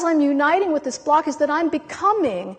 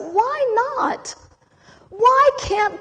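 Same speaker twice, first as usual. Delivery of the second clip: sharp, much higher cry of protest; upset outburst; 9 semitones higher, 3 dB louder, much slower than usual. Retold or imitated. Pleading with the cosmos, an upset high cry. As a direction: demand it, pitched up in frustration. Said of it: "I begin to get really upset".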